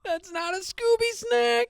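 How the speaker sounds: high, slow Shaggy voice; Shaggy impression. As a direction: drawl it like Shaggy. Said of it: "what else does he say?".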